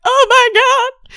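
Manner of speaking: high, loud shocked cry; mock horror as Vi sees the ruins; shocked gasp. Retelling Vi finding her brother dead, high shocked cry.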